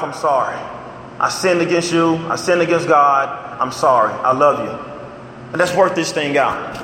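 mean every word, gently; tender apology. Retold or imitated. Husband apologising to his wife, low and tender.

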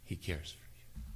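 a broken whisper. Real, not acted. Preacher too moved to voice it: whisper. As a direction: breathe it out, emotion taking over. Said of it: "I can't say this and do it justice".

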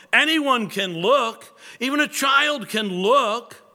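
a plain man pleading with a snowbound chapel; passionate lay preacher. Retold or imitated. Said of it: "So Spurgeon now quoting the, the man".

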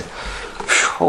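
airy exhale of relief; voiceless whoosh of breath; relieved whew. Acted. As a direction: blow out a soft "whew". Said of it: "did you go".

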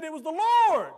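high, loud proclamation; preaching shout. Retold or imitated. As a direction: hammer it home to the congregation. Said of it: "he was honest and he said".

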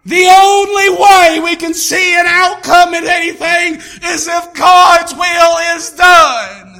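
loud, slow preaching build; fiery sermon peak; preaching at full force. Real, not acted.

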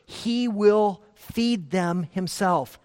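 slow, weighty stress on feed; emphatic reading. Shown as a demonstration. Preacher quoting scripture to his parish, slow and emphatic. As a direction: lean hard into feed. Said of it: "is so emphatic".

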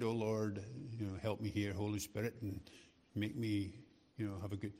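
"Oh Lord, help me here" quietly prayed; quiet prayer. Retold or imitated. Preacher retelling his own quiet prayer before meeting soldiers, soft. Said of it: "I said".

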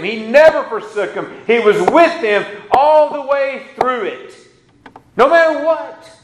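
high, fervent, driving preacher voice; preaching at full force. Acted. Pastor preaching to the church, high and fervent.

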